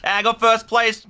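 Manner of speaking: high, loud and slow with triumph; excited. Real, not acted. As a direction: crow about the win. Wins the round in game.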